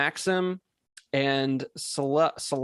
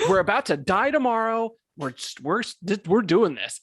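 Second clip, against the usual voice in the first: a touch higher, hammed-up rush; mock lover's urgency; 5 semitones higher than usual. Mocking RPG lovers who fall for each other, hammed-up urgency. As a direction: keep hamming up the doomed lovers.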